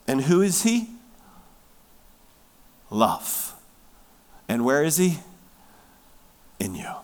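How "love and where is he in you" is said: ordinary talk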